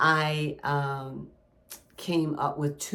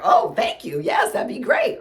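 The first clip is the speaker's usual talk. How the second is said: grateful reply; 7 semitones higher, 8 dB louder than usual. Retold or imitated. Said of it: "I'm like".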